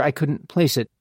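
ordinary delivery, nothing marked

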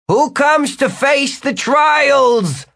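very high, slow, grand wizard voice; ceremonial challenge. Acted. Hovering wizard challenging the newcomers, high drawn-out proclamation. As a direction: call it out slowly across a great hall.

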